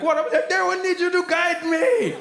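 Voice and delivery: high, laughing mom imitation. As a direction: mimic your mom asking for help. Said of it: "she'd come in and be like".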